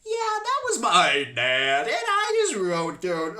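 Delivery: put-on mix a girl's voice and an old guy's, dragged out; failing voice filter. Stranger's girl-voice filter dying mid-call, glitching slowly. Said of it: "her voice literally started, like, failing".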